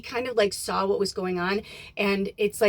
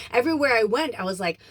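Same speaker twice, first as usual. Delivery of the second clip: ordinary talk, nothing marked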